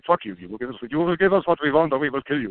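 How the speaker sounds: imitating a comic-book Nazi demanding the secret from monks; mock movie Nazi. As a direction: sneer it like a cartoon Nazi officer. Nazis in movie threaten monks.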